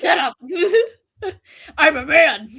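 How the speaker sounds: real laughter breaking through the words; laughing protest. Real, not acted. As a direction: protest while cracking up. Player laughs at own voice attempt.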